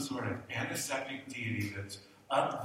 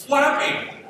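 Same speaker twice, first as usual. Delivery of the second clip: laughing alarmed cry; 13 semitones higher, 10 dB louder than usual. Retold or imitated. Retelling the scariest moment.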